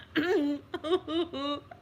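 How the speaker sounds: high, helpless re-enacted laughter; imitated laughing fit. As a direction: mimic laughter that won't stop. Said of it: "like that".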